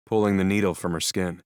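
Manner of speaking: plain reading voice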